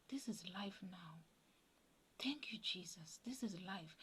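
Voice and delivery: soft, near-whispered retold thought; hushed gratitude. Woman thanks Jesus for her new life, hushed gratitude. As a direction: breathe thanks to yourself, very softly.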